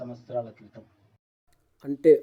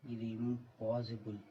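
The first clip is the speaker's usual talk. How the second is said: slow and much softer, in disbelief; said slowly; 9 dB quieter, slower than usual. A narrator's reading. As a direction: drag it out quietly, stunned. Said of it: "Arjun నెమ్మదిగా అన్నాడు".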